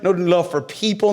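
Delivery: usual speaking voice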